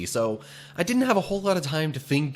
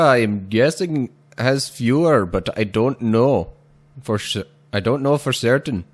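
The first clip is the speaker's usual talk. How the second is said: loud hammed-up Indian accent; lightly put-on Indian accent; 3 dB louder than usual. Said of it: "that last one was my attempt at doing, like, an Indian accent".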